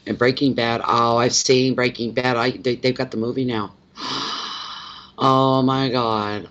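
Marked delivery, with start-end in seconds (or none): 4.0-4.9 sharp gasp. Real, not acted. Reacting to Breaking Bad movie news. 5.2-6.5 drawn-out, awed Oh my God; stunned slow oh my god. Real, not acted. To viewers, amazed at the Breaking Bad movie news, slow and awed.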